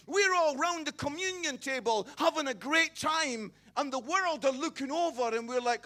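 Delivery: loud, mocking churchy boast; mock smug shout. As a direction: ham up the smug insiders, shouting. Preacher mocking smug church insiders.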